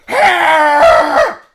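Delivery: high, loud wordless shout; imitated angry yell. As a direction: bellow it like an enraged caller. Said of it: "that's what it sounded like".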